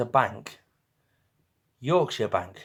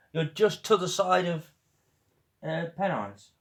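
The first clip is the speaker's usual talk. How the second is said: ordinary talk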